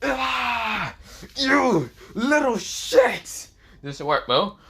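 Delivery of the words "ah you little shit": high, drawn-out agonized cry; acted howl of pain and rage; pained scream. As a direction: scream it slowly through pain, high-pitched. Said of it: "fallen angel screaming out and painting".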